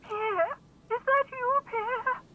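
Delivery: put-on high quavering old woman's voice; an old woman hoping it's her grandson; old woman on phone. Old woman asking caller about Pierre.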